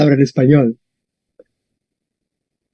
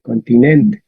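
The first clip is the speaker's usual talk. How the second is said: "Continentes" lower, stressed on the NEN syllable; 7 semitones lower, slower than usual. Shown as a demonstration. Teacher showing the accent stays put, NEN stressed in "Continentes". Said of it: "el acento se queda ahí".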